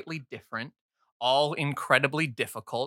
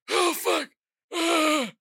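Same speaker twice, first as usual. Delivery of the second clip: high strained gasp of pain; pained NPC groan; slower than usual. Acted. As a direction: cry out as the arrow hits. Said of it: "and he goes like".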